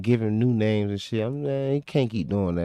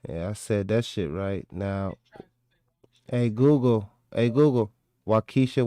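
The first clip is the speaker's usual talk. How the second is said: ordinary talk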